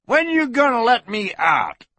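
high-pitched prisoner's holler; hoarse yell. Acted. Prisoner demanding release from the sheriff, hoarse yell. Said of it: "yelled a hoarse voice".

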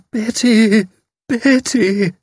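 very high, wailing moan.

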